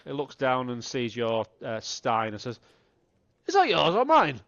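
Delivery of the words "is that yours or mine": loud, higher confused question; befuddled drunk. Acted. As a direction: squint at the stein and bellow it. Drunk asks about the stein.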